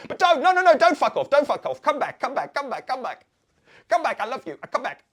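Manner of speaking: high, loud mock begging; frantic pleading. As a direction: plead desperately for them to come back. Begging them back after dismissal.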